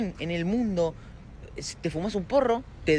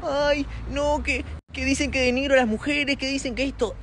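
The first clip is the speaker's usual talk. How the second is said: higher, put-on whiny voice; 6 semitones higher than usual. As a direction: parody his complaining stories. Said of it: "decís".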